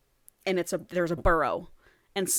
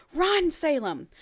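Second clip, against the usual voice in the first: urgent call; 8 semitones higher, slower than usual. Acted. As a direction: yell at her to get away. Warning friend to flee.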